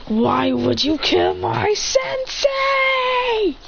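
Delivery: very slow, high wail building; drawn-out anime scream; anguished shout. Acted. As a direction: scream it in grief and rage. Avenger confronts killers.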